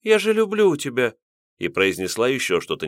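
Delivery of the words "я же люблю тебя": put-on high, pleading young woman; pleading girl. A narrator's reading. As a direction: beg him as a frightened girl. Girl pleads on her knees.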